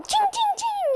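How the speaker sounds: high sung ching ching; playful high-pitched sword sounds; mock sword clangs. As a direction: clash imaginary swords with your voice. Sword battle sound effect.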